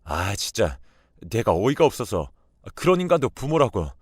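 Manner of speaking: an angry husband storming in; furious husband. Acted. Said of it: "남편이 노발대발하면서". (화가 치민 남편의 격한 목소리)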